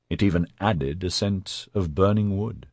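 plain reading voice

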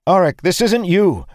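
high, loud appeal mid-fight; strained, high-pitched protest; urgent plea. A narrator's reading. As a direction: call out desperately to a friend. Pleading amid a sword fight.

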